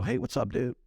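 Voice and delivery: casual smart-ass greeting, retold; cocky mock-friendly hello. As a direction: toss it off cheekily, pitch lifted. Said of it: "kinda being a smart ass".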